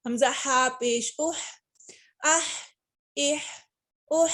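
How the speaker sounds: आह with air pushed from the throat; breathy throat h. Shown as a demonstration. Teacher to class, airy throat h. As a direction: let air out on आह, इह, उह. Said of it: "हा को हम हलक से पढ़ते हैं".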